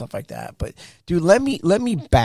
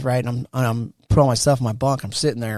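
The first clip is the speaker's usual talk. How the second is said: ordinary delivery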